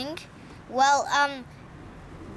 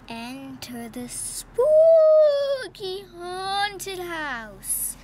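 slow, drawn-out spooky announcer; 5 semitones higher, 5 dB louder, much slower than usual. Pretend. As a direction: stretch it out like a ghost host. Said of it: "Your, your freaky voice is terrible".

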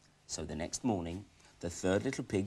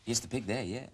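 usual speaking voice

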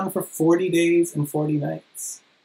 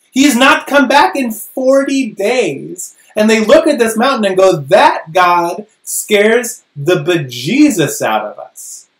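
louder, higher, slowing, dropping to a breath at the end; preaching intensity; 6 semitones higher, 6 dB louder, slower than usual. Real, not acted. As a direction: build the terror then whisper the end. Preacher builds to people's fear.

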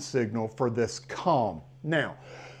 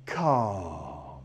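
low, drawn-out calm; low soothing calm; 7 semitones lower, 4 dB quieter than usual. Shown as a demonstration. Trainer settling his dog with a low, drawn-out calm. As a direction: bring the dog back down with calm. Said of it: "I just get big love".

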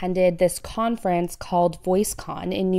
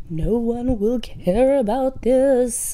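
slow, higher, louder ghostly moan; scary ghost voice; 4 semitones higher, 4 dB louder, slower than usual. Shown as a demonstration. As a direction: spook it out like a ghost. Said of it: "like, a scary ghost voice".

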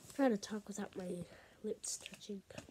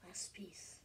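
whispering; 3 semitones lower, 11 dB quieter than usual. Real, not acted. Hushed focused work.

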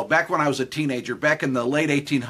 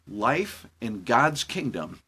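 slow, lower devotional voice; quiet preaching; 5 semitones lower, 3 dB quieter than usual. Acted. Pastor closing his story with God's kingdom, slow and low. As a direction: draw the lesson gently, slowly.

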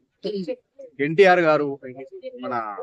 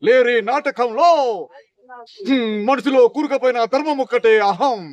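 Sai Kumar dialogue; 5 semitones higher than usual. Acted. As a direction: thunder it out like a stage villain. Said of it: "Sai Kumar గారు dialogue".

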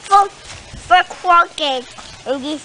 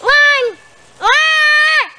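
screaming; 10 semitones higher, 4 dB louder than usual. Real, not acted. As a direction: scream the warning, high and urgent. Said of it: "Why are you screaming at her?".

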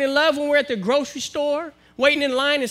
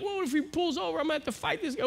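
high, soft muttered aside; quiet hesitant inner voice; muttered thought; 5 semitones higher, 8 dB quieter, faster than usual. Retold or imitated. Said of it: "I'm like".

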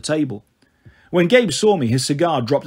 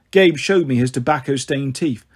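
usual speaking voice

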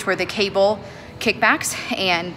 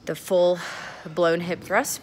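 ordinary talk, nothing marked

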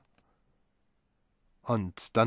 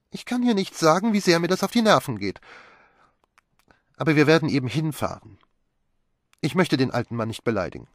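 put-on much higher, louder, fast young wife; Magdalene irritated; 7 semitones higher, 6 dB louder than usual. A narrator's reading. Wife complains about the sneaking servant, high and fast. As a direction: rattle off the grievance.

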